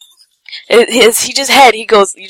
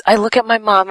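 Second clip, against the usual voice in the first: ordinary delivery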